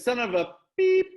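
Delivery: high, loud, mock-rude wife snapping at husband. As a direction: play the rude wife cursing him. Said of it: "She's very rude to him".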